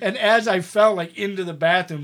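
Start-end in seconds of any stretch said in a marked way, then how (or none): none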